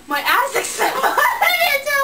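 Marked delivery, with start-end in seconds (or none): 1.0-1.7 a sudden high shriek of laughter; burst of laughter. Real, not acted. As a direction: lets a loud laugh escape. Bursts out laughing mid-sentence.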